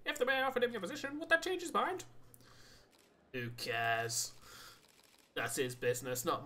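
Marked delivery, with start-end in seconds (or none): 0.1-2.0 reporter voice. Acted. Reporter questioning at press conference.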